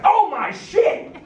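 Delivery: high, slow startled cuss; mock startled dad; shocked dad. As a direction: blurt it out in shock. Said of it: "he just went".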